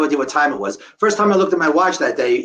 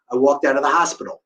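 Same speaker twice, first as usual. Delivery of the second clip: ordinary delivery, nothing marked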